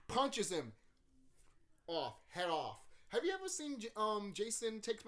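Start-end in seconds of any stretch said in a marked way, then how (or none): none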